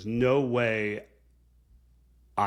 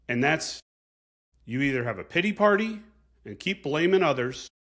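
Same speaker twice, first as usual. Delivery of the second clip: higher, louder drill-chief push; forceful pep talk; 5 semitones higher, 3 dB louder than usual. Retold or imitated. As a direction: fire up the recruit like a tough chief. Chief's fierce pep talk retold.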